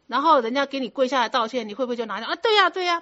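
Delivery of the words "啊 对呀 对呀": eager agreement. Retold or imitated. Imitating a listener agreeing.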